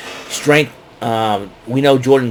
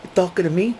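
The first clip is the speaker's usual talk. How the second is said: De Niro impression; 7 semitones higher, 5 dB quieter, faster than usual. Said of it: "I get a kick out of saying that".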